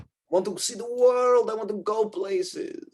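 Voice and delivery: high, slowing dreamer's voice; long for adventure, pitched well up; yearning barmaid. Acted. Wendy voices her longing to travel.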